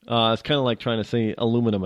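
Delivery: usual speaking voice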